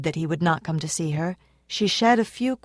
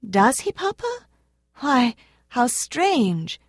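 much higher, slow amazed exclamation; a little girl amazed at the news; astonished; 6 semitones higher, slower than usual. A narrator's reading. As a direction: let the wonder stretch out. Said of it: "exclaimed ... lost in astonishment".

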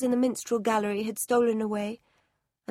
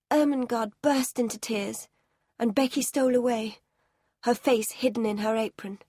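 ordinary delivery

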